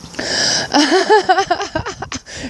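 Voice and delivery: long, high-pitched peal of laughter. Real, not acted. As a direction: burst into a sustained high laugh. Laughs about her missing stove.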